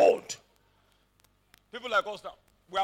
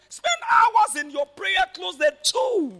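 much higher, slow, loud exhortation; preaching shout; 10 semitones higher, 5 dB louder, slower than usual. Real, not acted. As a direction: drive the point home to the church. Preaching climax over music.

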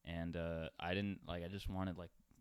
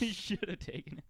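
laughing; 11 semitones higher than usual. Real, not acted. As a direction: tease him while cracking up. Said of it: "repeats the line laughing".

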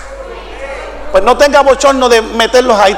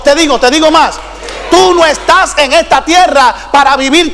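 raised-pitch, driving sermon cry; full-force preaching; 4 semitones higher than usual. Real, not acted. Preacher rallies the congregation to keep working, full-force preaching. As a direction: preach it out with ringing, insistent repetition.

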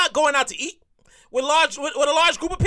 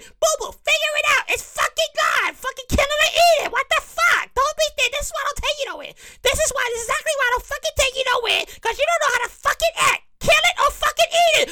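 scolding mother; 11 semitones higher than usual. Retold or imitated. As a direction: scold him like an embarrassed mother. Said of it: "I can hear my mother say".

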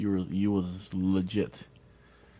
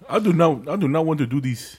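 put-on Nigerian accent; 3 semitones higher than usual. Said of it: "[name] speaking in a Nigerian accent".

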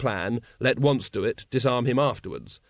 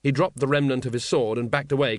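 plain reading voice

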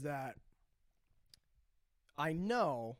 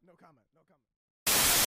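louder curt no comment; mock athlete brush-off; 10 dB louder than usual. Player dodging questions from reporters, a loud brush-off.

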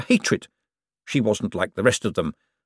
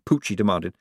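plain reading voice

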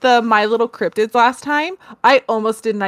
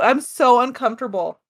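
usual speaking voice

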